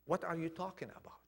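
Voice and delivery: quick, hushed brush-off; dismissive. Retold or imitated. Jesus waving away Peter's denial, dismissive. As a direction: toss it away fast, almost whispered.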